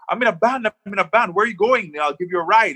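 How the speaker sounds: chatty high voice of the driver offering a ride; imitating the driver. Driver's voice retold.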